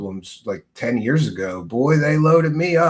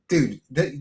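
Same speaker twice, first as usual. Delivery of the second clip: usual speaking voice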